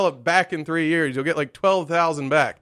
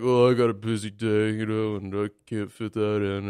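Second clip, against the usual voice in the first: imitating brother's excuse; 6 semitones lower than usual. Brother dodging paying for the euthanasia, low mock excuse. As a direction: mimic a brother making lame excuses, low.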